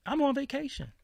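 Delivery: plead, fed up; exasperated. Retold or imitated. Telling the stranger he is just on vacation, high and exasperated.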